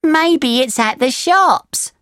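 put-on high, drawn-out taunting boy; schoolboy, mocking. A narrator's reading. Another boy mocks Pinocchio, slow high taunt.

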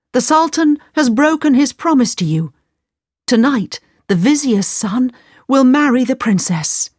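a mother indignant for her son; worried mother breaking bad news; upset mother. Mother reports broken promise.

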